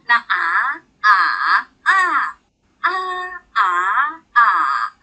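loud drawn-out ah across the four tones; tone demo. Shown as a demonstration. Teacher shows class the four tones of 啊, loud and drawn out. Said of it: "把啊的声音发得很好听".

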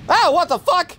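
high, loud furious outburst; raging gamer. Real, not acted. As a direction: yelp it out in disbelief. Sudden death in game.

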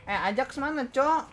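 calling out. Real, not acted. Calling out for a missing friend.

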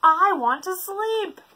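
high, loud cranky complaint; grumpy whine. Acted. Leopard to the zoo, cranky. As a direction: demand sleep.

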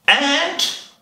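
high-pitched call for the ant. Acted. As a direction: call out as if searching for it. Anteater calls for the missing ant.

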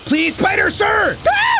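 ordinary talk

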